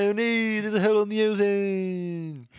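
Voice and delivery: high, slow hammy alarm; mock panic. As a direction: ham up the disaster.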